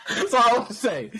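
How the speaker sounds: giggling the line out high; laughing. Real, not acted. Giggling while gushing about the actress. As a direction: laugh through it, bashful.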